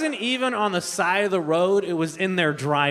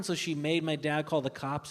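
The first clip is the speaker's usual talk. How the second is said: ordinary talk, nothing marked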